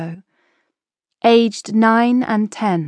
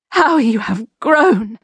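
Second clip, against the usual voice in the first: holding back tears; 4 semitones higher, 3 dB louder than usual. A narrator's reading. She fought tears.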